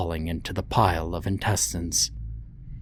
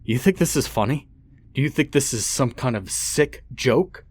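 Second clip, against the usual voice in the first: angry shout; 6 semitones higher than usual. Acted. Father screaming at his beaten son. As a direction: hurl the questions at him.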